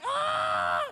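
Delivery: very high startled yelp; high cry of alarm; panicked yelp. Retold or imitated. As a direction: squeak in shock. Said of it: "and I'm like".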